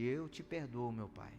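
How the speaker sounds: a man quietly forgiving his father, understated; tearful forgiveness. Retold or imitated. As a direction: let it come out hushed and heavy.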